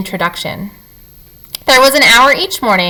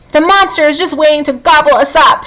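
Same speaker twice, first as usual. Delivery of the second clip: shrieking; 7 semitones higher than usual. A narrator's reading. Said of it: "shrieked Dig Down".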